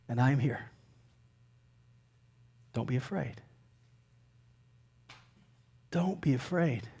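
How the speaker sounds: very soft, low, gentle reassurance; Jesus reassuring. Acted. Jesus calming the frightened disciples, hushed and reassuring. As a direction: drop to a hush, with long pauses. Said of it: "I believe that Jesus said it this way".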